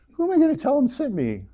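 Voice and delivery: high squeak. Retold or imitated. Moses voiced in retold story.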